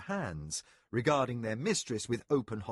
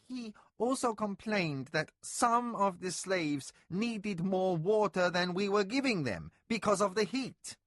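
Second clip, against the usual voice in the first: anxious overseer; 8 semitones higher than usual. A narrator's reading. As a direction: explain yourself nervously to your mistress. Servant voice held.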